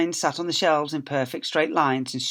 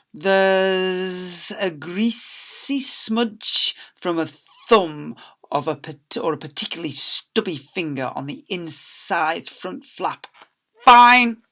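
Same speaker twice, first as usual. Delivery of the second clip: slow, picky librarian building to a loud high FINE; a nasty old librarian; stern librarian; 4 semitones higher, 3 dB louder, slower than usual. Acted. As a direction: drag out the faults, then bark the fine. Librarian inspecting returned books.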